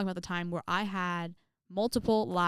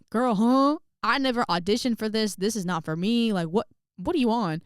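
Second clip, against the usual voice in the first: loud, acted disbelief, pitched up at the start; indignant protest; 3 semitones higher, 6 dB louder than usual. Pupil protesting to the teacher about singing a solo, loud mock indignation. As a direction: push back hard, playing up the outrage.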